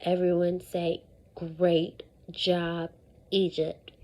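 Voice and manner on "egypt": usual speaking voice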